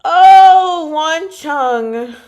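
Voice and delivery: loud, high squeal of delight on the oh; gushing. Real, not acted. Fan seeing Wan Cheng's art, delighted squeal. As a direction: gush over him like a fan.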